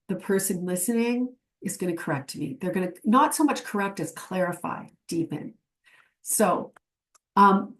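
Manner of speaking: normal talking voice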